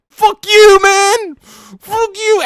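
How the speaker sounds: squealing in pain and rage; hurt kid yelling. Retold or imitated. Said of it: "He's like".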